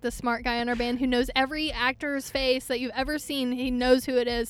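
usual speaking voice

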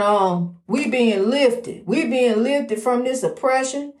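normal talking voice

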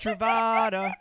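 loud, clipped-free call of one word; mock bird call.

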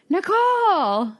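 drawn-out, uncertain shout-out of NICOLE; questioning name call. Retold or imitated. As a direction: call NICOLE slowly, as if unsure it is the right name. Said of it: "with a question mark on the end".

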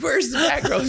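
hammed-up shouted question; high mock shout. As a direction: holler it way up high, imitating a drunken demand. Repeats his question, now loudly.

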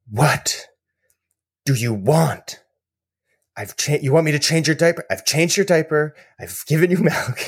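put-on a worn-out father begging a crying baby to tell him; exasperated new dad. Worn-out dad pleading with his crying baby, pitched high.

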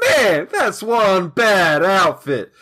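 slow, drawn-out movie quote; a slow, tickled quote of the line; quoted movie line. Retold or imitated. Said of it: "someone says the best line".

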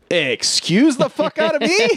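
very high incredulous squawk; hammed-up affronted disbelief; mock indignation. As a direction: act outraged, voice shooting way up. Prisoner outraged at airport detour.

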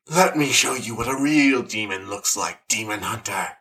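a hint of a sinister monster; creepy demon taunt.

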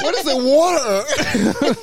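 high, slow laughing question; puzzled and cracking up; laughing through a baffled question; laughing disbelief. Retold or imitated. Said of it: "I was like".

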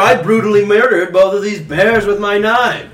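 yelling boss. Acted. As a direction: bark it at the crying worker. Boss yelling at homesick worker.

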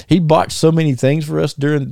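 ordinary talk, nothing marked

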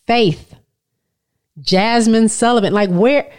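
ordinary talk

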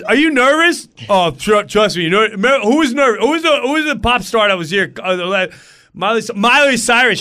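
high, loud, pushy chatter; hammed-up excited know-it-all; babbling pest. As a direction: babble loudly at a nervous friend.

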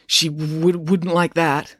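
put-on stuttering boy; stammering. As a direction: stammer on w-, wouldn't. Joe stammers.

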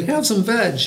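high, lightly acted hoot; hooted offer. A narrator's reading. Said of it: "they hooted".

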